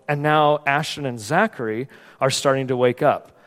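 ordinary delivery

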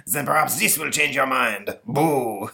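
lightly French ghost voice. Acted. Ghost Napoleon scaring the boy, light French accent. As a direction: spook him, a bit French. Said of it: "ghost with a French accent".

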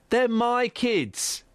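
very slow, dragged insistence; slow stubborn refrain. Acted. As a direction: drag each word out defiantly.

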